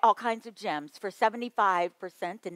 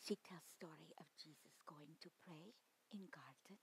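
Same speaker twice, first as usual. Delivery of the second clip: whispered, much softer; soft-spoken whisper; 24 dB quieter than usual. Shown as a demonstration. Teacher shows Winnie Wang's voice to the workshop, whispered. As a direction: hush your voice as gentle Winnie Wang in her garden. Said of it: "very soft-spoken".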